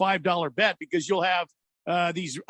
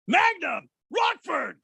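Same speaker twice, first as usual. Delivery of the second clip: high-pitched comic holler of rival names; mock feud shouting; 11 semitones higher, much slower than usual. Two friends feuding over TV detectives, mock shouting. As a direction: hurl the names like squabbling rivals. Acting out their daily feud.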